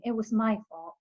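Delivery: plain reading voice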